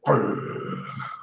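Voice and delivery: low, soft imitated snarl; imitated dog growl. Storyteller imitating the growling dog, low and soft. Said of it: "and he's going".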